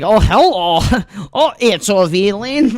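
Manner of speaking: annoying Russian character. Retold or imitated. Doing the annoying Russian character for John at the airport. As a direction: play the loud, annoying Russian. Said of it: "I do an annoying Russian character".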